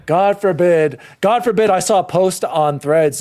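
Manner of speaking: ordinary delivery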